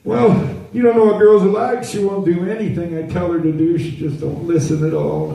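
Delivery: put-on high, whiny little boy voice; a toddler grumbling about girls like a grown man; little boy complaining.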